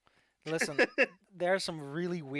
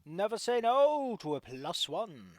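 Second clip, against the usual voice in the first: high, loud excited cheer; 6 semitones higher, 7 dB louder than usual. Real, not acted. GM cheers enthusiastically.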